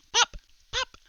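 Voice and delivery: squeaky high pop pop; mock popping sounds. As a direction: pop it like a bursting stitch. Popping sound for stitches bursting.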